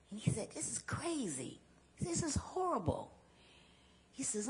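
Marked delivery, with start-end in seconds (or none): 0.5-3.1 shocked trucker. Retold or imitated. Trucker reacts to her story.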